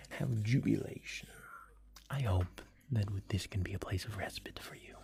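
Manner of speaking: ordinary talk, nothing marked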